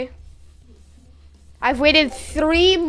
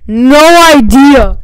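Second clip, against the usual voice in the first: slow, booming shouted answer; very loud, drawn-out yell; 2 semitones lower, 14 dB louder, slower than usual. Real, not acted. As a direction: holler it, stretching each word. Shouted answer, then repeated quietly.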